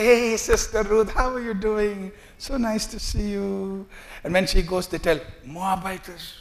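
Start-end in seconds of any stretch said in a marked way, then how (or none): 0.0-3.9 fake sweetness. Fake friendly church greeting. 5.4-6.3 muttered sneer. Acted. Sneering behind her back.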